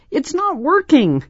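put-on high, upset child voice; scared and shaking. A narrator's reading. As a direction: cry out in frightened frustration. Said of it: "Angela said, shaking".

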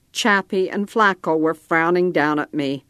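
usual speaking voice

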